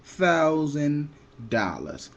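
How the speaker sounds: slow, deliberate official repeat; officer repeating. Retold or imitated. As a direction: spell the figure out slowly like a bored officer. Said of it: "He said".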